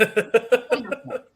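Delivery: slow, laughing, ironic; laughing sarcasm. Real, not acted. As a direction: drag it out through a laugh.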